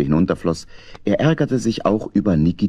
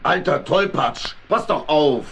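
grumpy scolding; 7 semitones higher, slower than usual. Acted. Grumpy Dominik scolds hedgehog.

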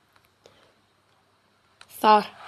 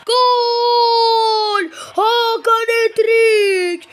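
high, slow ecstatic goal scream; screaming football commentator; 6 semitones higher, much slower than usual. Acted. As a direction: scream the hat-trick goal. Hat-trick goal celebration.

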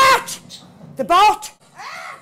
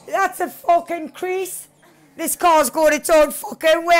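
ordinary talk, nothing marked

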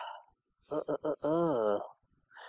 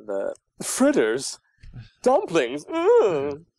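relishing the food words, swooping higher; arrogant pansy; 4 semitones higher, 3 dB louder, much slower than usual. Acted. Actor showing off an arrogant prince's favourite words, haughty relish. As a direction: savour each word like a haughty gourmand. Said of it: "words to say in that kind of attitude".

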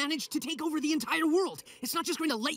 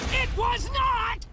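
slow indignant shout; 8 semitones higher, 5 dB louder, much slower than usual. Acted. Insisting on being heeded.

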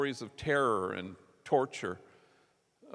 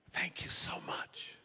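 breathy hushed gratitude, pitched up and slow; over-the-top thanks; 7 semitones higher, 7 dB quieter, slower than usual. Retold or imitated. Mario thanking the flight attendant, gushing whisper. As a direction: whisper the thanks as if it's overwhelming. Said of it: "Just, like, over the top".

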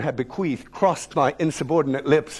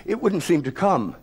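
plain reading voice

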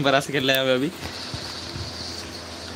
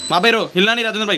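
urgent fast instructions; 6 semitones higher, much faster than usual. Real, not acted. Urging teammate to stay put.

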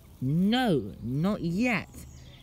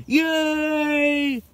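excited cheer; 9 semitones higher, 5 dB louder than usual. Acted. Magic trick reveals new location.

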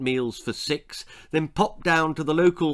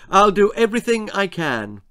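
higher, stammering politician voice; flustered Prime Minister; 6 semitones higher, 5 dB louder than usual. Acted. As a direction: caught off guard, fumble the title. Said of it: "said the Prime Minister".

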